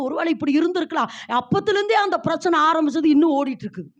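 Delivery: ordinary talk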